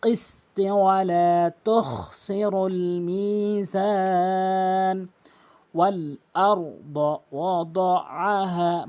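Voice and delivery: slow, drawn-out chanted recitation; Quran recitation. Shown as a demonstration. Tajweed teacher reciting the verse for students, slow and melodic. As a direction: recite it melodically, stretching every vowel.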